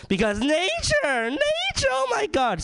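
high, slow shrieking of nature; hammed-up high wail; mock outrage. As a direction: squeal it like an outraged activist. Mocking environmentalist outrage.

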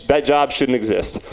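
normal talking voice

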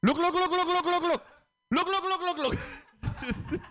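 very high rapid-fire "look" bursts; mom demanding attention. Retold or imitated. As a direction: imitate mom nagging, shrieky and relentless. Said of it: "my mom would say it in English".

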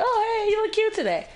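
high, light, casual greeting; chirpy imitation of a friendly coworker; imitated friendly compliment. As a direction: toss off a sweet compliment in a high voice. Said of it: "she's just like".

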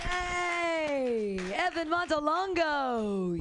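loud call across the room. Real, not acted. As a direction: call his name out loud. Calling the comic back on.

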